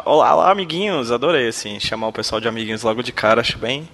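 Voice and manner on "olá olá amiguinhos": high, loud sing-song greeting; cartoon princess. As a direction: chirp it like a kids' show host. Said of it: "Eu gostei da parte que você chama". (saudação aguda e cantada de princesa de desenho)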